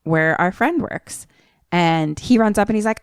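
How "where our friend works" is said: usual speaking voice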